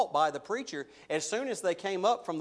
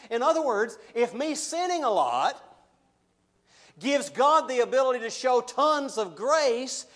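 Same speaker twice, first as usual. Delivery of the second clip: loud, mocking objector voice, higher; 5 semitones higher, 5 dB louder than usual. Preacher voicing the listener who'd sin for more grace, mocking and loud. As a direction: ham up the cheeky sinner's argument, half laughing. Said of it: "he anticipates them kind of saying this".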